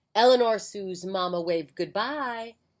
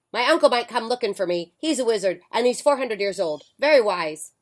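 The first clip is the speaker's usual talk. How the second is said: louder, quick call back while leaving; calling out over shoulder; 3 semitones higher, 7 dB louder than usual. Acted. Witch calling back to the mother while flying off. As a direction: fling the words over your shoulder as you go. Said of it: "she called out over her shoulder".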